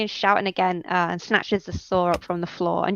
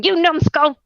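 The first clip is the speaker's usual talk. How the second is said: high, loud insult, slamming the slab; furious kobold yell; 9 semitones higher, 5 dB louder than usual. Acted. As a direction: spit the insult out in a rage. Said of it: "slamming his hands down on the slab and going".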